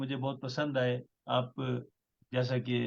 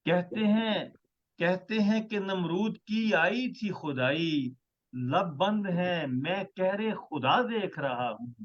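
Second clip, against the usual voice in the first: measured, stately poetry recital; ghazal recitation; 5 semitones higher than usual. Acted. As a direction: recite each line with weight. Poet recites his ghazal.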